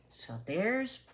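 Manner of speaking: hushed aside. Real, not acted.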